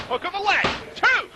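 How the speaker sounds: excited count. Acted. Near-fall count call.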